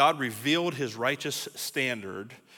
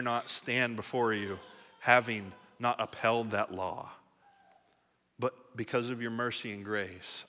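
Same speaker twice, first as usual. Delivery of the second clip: humble prayer; 4 semitones lower, 6 dB quieter than usual. Acted. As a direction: speak it as a hushed confession to God. Speaker voices prayer to God.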